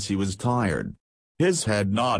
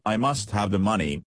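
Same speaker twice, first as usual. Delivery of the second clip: plain reading voice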